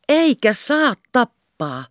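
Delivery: high, slow and firm, "Eikä" stressed; emphatic. Acted. Kerttu firmly contradicting the captain, emphatic. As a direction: put your foot down on "Eikä". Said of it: "sanoi Kerttu pontevasti".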